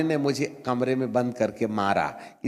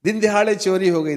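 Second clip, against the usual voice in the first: higher, louder indignant cry; indignant outburst; 7 semitones higher, 6 dB louder than usual. Retold or imitated. Inner voice scorning his loss, loud outrage. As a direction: burst out in outrage.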